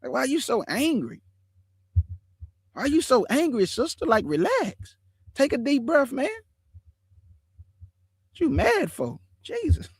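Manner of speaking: high, soothing plea to calm down; calming quote. Retold or imitated. To an angry brother: high, soothing plea to calm down. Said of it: "I'll be like".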